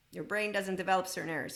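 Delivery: usual speaking voice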